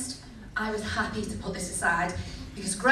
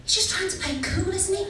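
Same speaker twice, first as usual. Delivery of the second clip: put-on girlfriends' voice; 8 semitones higher than usual. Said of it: "They turned to me and said".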